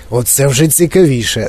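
plain reading voice

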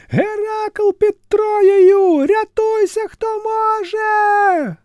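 високий протяжний крик, повільно; кричить у паніці, розтягуючи слова; панічний крик натовпу. A narrator's reading. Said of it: "незграбно підхопив натовп". (натовп у паніці кричить)

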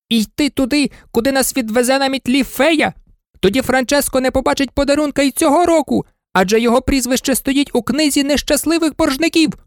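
very high, insistent squeaky voice; arguing toy. A narrator's reading. Toy arguing amid a furious squabble, high insistent cry. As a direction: argue in a high pitch, speeding up. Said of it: "Кожен кричав своє".

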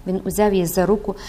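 ordinary delivery, nothing marked

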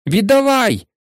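high, forceful demand; shouted demand. A narrator's reading. Harry demanding the ball from Malfoy, shouted demand. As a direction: call out, pitched well up. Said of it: "крикнув Гаррі".

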